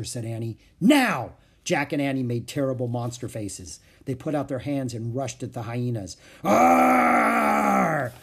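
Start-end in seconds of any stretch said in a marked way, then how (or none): 0.8-1.3 sudden loud, high command; shouted signal. Acted. Annie to Jack before scaring hyenas, shouted signal. 6.4-8.1 loud, high roaring shout; long scary monster yell. A narrator's reading. Jack and Annie rushing at hyenas, monster roar. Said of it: "they shouted".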